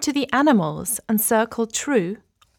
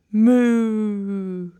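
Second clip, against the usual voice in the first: cow moo. Acted. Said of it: "I am a cow".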